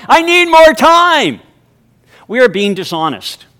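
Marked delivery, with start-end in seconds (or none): 0.1-1.4 high, loud, slow mock complaint; hammed-up plea for more time; mock whine. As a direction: whine it like a harried person. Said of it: "when you say something like".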